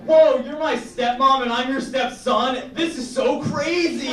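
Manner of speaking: high, slow, loud overacted shock; mock astonishment. The stepson reacting to his stepmom, in hammed-up astonishment. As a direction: ham up the big reveal. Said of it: "Or, like".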